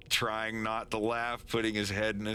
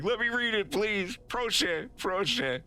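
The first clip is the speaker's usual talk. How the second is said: high, urgent begging; high-pitched frantic please; 11 semitones higher than usual. Acted. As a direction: plead desperately for permission. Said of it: "he's just, like, begging. You're like".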